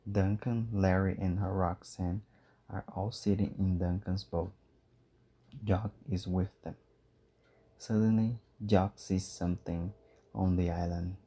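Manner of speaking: ordinary delivery, nothing marked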